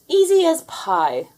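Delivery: high, loud, slow and breezy; confident boast. A narrator's reading. Melly eyeing the kitchen, high and cocky. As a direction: drawl it with smug ease. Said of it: "said Princess Melly".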